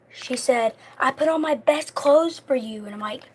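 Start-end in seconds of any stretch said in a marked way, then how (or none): 1.0-2.8 higher-pitched wounded protest, imitated; mock crying. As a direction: lightly mimic her tearful complaint.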